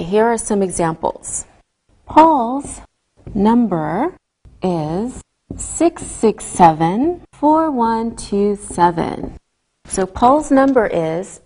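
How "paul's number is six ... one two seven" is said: slow, each digit separate. Shown as a demonstration. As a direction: read the digits one by one. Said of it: "you read each number individually".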